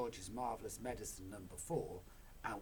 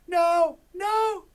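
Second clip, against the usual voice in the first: very high, very loud shout; too late to stop her; desperate cry; 22 dB louder, much slower than usual. Acted. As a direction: George and his mother cry out. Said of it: "the other two cried".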